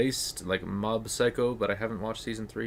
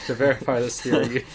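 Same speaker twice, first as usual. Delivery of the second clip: laughing; 5 dB louder than usual. Real, not acted. Reading game text, cracking up.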